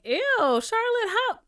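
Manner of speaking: high, loud grossed-out squeal; a disgusted viewer's high-pitched ew; mock disgust. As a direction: recoil at her, high and loud. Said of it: "I was like".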